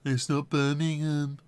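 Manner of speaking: slow, lightly put-on Kent accent. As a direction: correct them, drawn out in a hint of Kent accent. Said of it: "Kent has a few accents".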